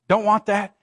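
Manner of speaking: ordinary talk, nothing marked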